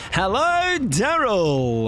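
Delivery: very high, loud drawn-out Hello; sing-song greeting. Pretend.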